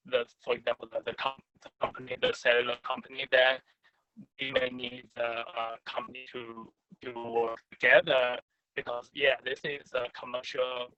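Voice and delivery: choppy, cutting out connection; breaking up. Real, not acted. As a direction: audio dropping in and out. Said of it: "I think you're breaking up".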